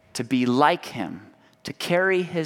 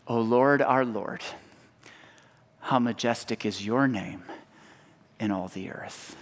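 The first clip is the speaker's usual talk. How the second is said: softer and lower, fading to near silence; hushed reverent refrain; 5 semitones lower, 5 dB quieter than usual. Acted. Preacher returning to the psalm, hushed fading refrain. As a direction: let the praise sink into quiet awe.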